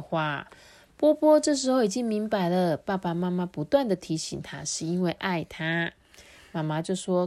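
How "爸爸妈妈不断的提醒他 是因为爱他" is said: ordinary delivery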